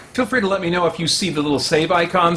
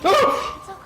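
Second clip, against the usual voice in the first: normal talking voice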